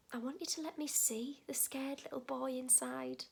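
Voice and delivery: soft, gentle, unhurried appeal; tender plea. Acted. Asking a man to show his feelings, soft and tender. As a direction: drop the volume and coax him gently.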